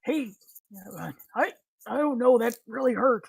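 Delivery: high startled cry of a poked man; poked NPC yelp. Acted. GM as the poked man, startled and hurt. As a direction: squeal like he just got poked hard.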